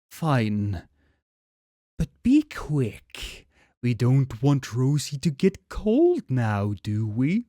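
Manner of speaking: slow, eerie sweetness; creepy mom voice. Acted. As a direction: menace the boy like a monster mom. Transformed mom speaks creepily.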